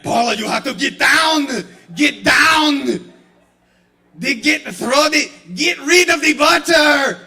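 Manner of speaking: high, loud barked action-hero yell; Arnold shouting orders. Acted. Action hero yelling at Paula about butter.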